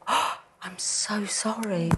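breathy whispered apology, drawn out slowly; hushed apology. Retold or imitated. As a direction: whisper it slowly as a remorseful mother. Retells mother's hushed apology.

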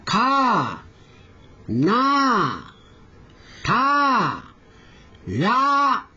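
slow, separated Korean syllables; syllable drill. Shown as a demonstration. Teacher leading pronunciation practice, one syllable at a time. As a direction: say each syllable alone with a clear mouth shape. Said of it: "口の形に注意しながら".

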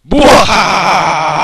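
slow booming villain cackle; loud gleeful evil laugh. Acted. Villain gloats while decommissioning.

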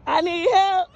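high-pitched, play-acted plea; mock cry for help. Vlogger joking that police want to fight her, mock alarm. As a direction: plead in a high voice, pretending to be attacked.

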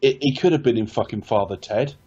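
ordinary delivery

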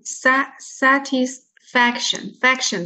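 "sa-" isolated, then slow "satisfaction", "faction" picked out; secondary and primary stress. Shown as a demonstration. Teacher explains stresses in a long word, slow syllable demo. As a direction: break "satisfaction" up, stressing "sa" lightly and "fac" fully. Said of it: "它也是有个次重音".

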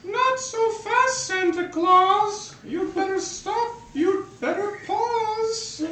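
high, slow villain voice; hammed-up high alien threat. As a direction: stop Santa in a squeaky menacing voice. Alien villain confronts Santa.

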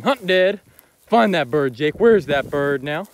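loud, slow call to a dog, rising; loud dog command. Shown as a demonstration. Showing viewers how he urges the dog, loud and slow. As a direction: call it out to the dog across the field. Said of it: "associate finding dead birds with me saying".

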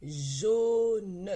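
"Jaune" stretched loudly, long "jau" then separate "ne"; word sounded out. Shown as a demonstration. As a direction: draw out both syllables of "Jaune", firm and loud. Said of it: "Maintenant, nous allons lire les mots". ("Jaune" déchiffré lentement, fort, syllabe par syllabe)

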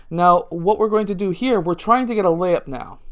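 ordinary talk

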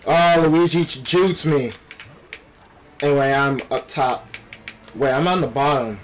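usual speaking voice

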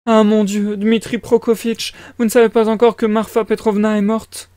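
high-pitched flustered mother; fretful mother character. Acted. Voices the mother.

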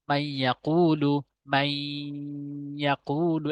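"মাই ইয়াকুলু" with a long nasal hum joining মাই to ইয়া; slow chant, holding the merged y of "মাই ইয়াকুলু" through the nose; ghunna recitation. Shown as a demonstration. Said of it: "এখানে কিন্তু গুণনা করতে হবে".

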